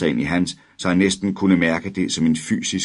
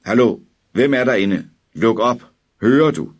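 loud, higher shouting through a door; man shouting outside; 5 semitones higher, 5 dB louder than usual. A narrator's reading. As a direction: call through the door, demanding. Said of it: "En mand råbte udefra". (en mand råber højt gennem døren)

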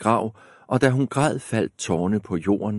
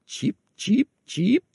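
very high, chirpy bird sounds; bird chirp; 13 semitones higher than usual. Acted. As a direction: peep like a tiny bird.

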